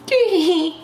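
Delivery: quick high giggle; amused giggle; short high laugh. Real, not acted. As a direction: let a little laugh slip out high. Laughing at own mozzie excuse.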